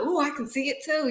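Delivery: high-pitched, eager squeal of support. Acted. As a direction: share the thrill in a high voice. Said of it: "I'm more excited than they are".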